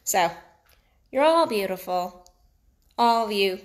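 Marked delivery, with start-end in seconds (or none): none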